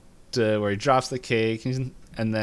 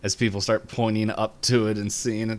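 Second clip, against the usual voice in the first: usual speaking voice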